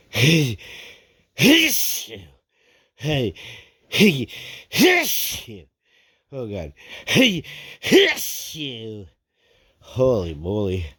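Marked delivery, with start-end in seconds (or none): 0.1-5.7 sneezes rising to a burst; sneeze windup. Real, not acted. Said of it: "here come the sneezes again". 7.0-9.1 sneeze windup. Real, not acted. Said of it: "here come the sneezes again".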